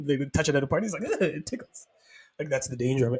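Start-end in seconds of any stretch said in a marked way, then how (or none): 1.0-1.9 high, soft ticklish voice; mimicked ticklishness. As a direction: act out being tickled, pitched up and light. Acts out character being tickled.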